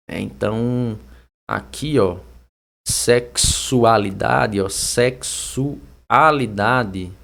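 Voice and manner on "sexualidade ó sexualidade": "sexualidade" slow, SEK-SU-A-LI-DA-DE; drawn-out "sexualidade", X as KS; pronunciation demo. Shown as a demonstration. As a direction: sound out "sexualidade" by syllables with K-S. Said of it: "Vai ficar desse jeito".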